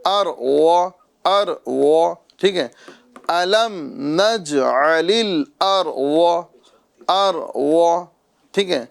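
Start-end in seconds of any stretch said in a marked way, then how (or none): 0.1-2.1 اروا drawn out slowly with a full, rounded ض; اروا recited syllable by syllable, full-mouthed ض; correct heavy Dad. Shown as a demonstration. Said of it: "یہ نہیں کرنا ہے، بلکہ". 3.3-8.1 slow, measured tajweed recitation; drawn-out teaching recitation; Quran recitation demo. Shown as a demonstration.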